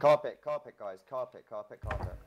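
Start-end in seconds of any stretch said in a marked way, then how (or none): 0.0-0.8 shouted rallying word; loud callout. Real, not acted. Leader calling the guild to carpet, shouting. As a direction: yell it to pull the team in.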